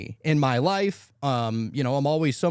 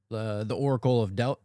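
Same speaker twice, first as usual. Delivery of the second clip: ordinary delivery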